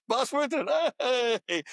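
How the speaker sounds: high, bright call of recognition; excited greeting. Retold or imitated. Boss calling out as he recognizes the guest. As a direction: greet an old friend with delight.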